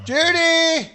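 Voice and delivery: calling out. Acted. Calling out to absent person.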